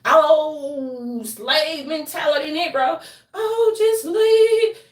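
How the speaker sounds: high, loud, slowed mocking wail; mocking shrill voice. Mocking the casino onlookers in a loud shrill wail. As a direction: caricature the onlookers in a shrill crying whine.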